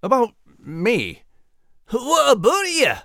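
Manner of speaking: hearty, teasing the nervous one; Max voice. Acted. Max teases the announcer, Scottish pirate lilt.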